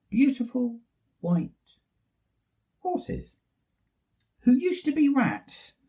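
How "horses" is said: ordinary delivery, nothing marked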